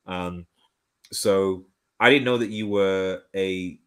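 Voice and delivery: ordinary talk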